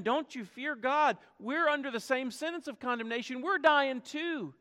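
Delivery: high, urgent rebuke; rebuking friend. Acted. Criminal rebuking the mocking criminal beside him, high urgent rebuke. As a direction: shake sense into his friend. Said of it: "he rebuked his friend".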